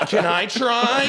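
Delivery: put-on high, giddy little-kid ask; mock eager kid. Teasing a friend's childhood self, high eager kid voice. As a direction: squeak it like an excited eight-year-old. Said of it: "You were like".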